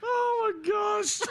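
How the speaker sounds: high, drawn-out words through laughter; cracking up, barely getting it out; helpless laughter. Real, not acted. Co-host undone by friend's impression, laughing helplessly.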